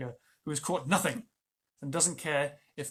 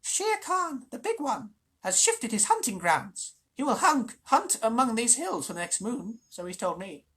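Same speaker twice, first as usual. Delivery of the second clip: high, sneering jackal voice; spiteful jackal; 9 semitones higher, 7 dB louder than usual. Acted. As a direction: relish delivering the bad news. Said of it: "Then he said spitefully".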